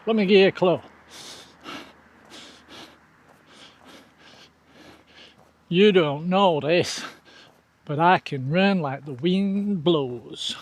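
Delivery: a badly faked Southern accent, dragged out like Forrest Gump; terrible accent. Dropping a Forrest Gump cap hint to viewers in a badly faked Southern accent. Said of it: "That was a terrible accent".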